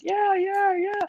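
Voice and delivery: very high sing-song yeah yeah; high falsetto yeahs. Retold or imitated. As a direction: squeal it way up high. Mimicking confident joke delivery.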